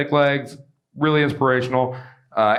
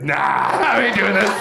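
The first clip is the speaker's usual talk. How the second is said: scared robber; 5 semitones higher, slower than usual. Retold or imitated. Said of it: "He's like".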